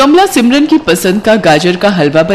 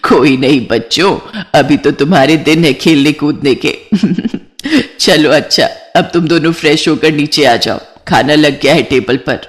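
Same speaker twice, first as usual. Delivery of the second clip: lower-pitched, easygoing motherly figure; indulgent mother-in-law; 4 semitones lower than usual. Acted. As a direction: tease the young couple like an amused mother-in-law. (नीची, लाड़ भरी सास की आवाज़)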